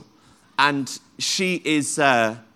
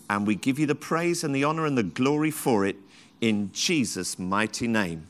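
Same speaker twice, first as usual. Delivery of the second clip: prayer; 4 semitones lower than usual. Acted. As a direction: pray it low and earnest to the Lord. Opening prayer to God.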